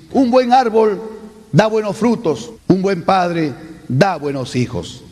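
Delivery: slow, falling close; descending finish to the speech; falling ending. Shown as a demonstration. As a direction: drop the voice at the end.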